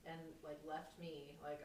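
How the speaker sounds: ordinary talk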